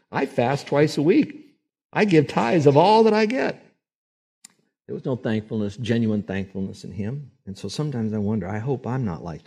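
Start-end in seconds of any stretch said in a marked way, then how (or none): 0.1-3.6 boastful Pharisee. Retold or imitated. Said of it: "the Pharisee in the temple who prayed".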